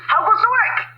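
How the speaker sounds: high, loud farmer call. Acted. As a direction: bellow it as the lazy farmer. Farmer calls from bed.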